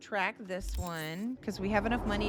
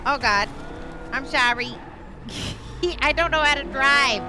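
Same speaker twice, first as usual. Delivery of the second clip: embarrassed exclamation; 4 semitones higher, 10 dB louder than usual. Real, not acted. Bumps into a pedestrian.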